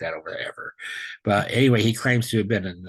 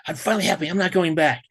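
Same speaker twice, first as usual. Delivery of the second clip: retold plea, much higher; upset defiance; 7 semitones higher, 4 dB louder than usual. Begging the Lord to stay, retold upset high plea. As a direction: insist you're finally happy. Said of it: "I kind of blew up a little bit".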